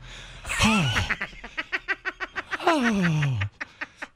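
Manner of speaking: high swooning mock sigh. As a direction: let out a dreamy sigh. Swooning over romantic gesture.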